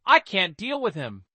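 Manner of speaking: usual speaking voice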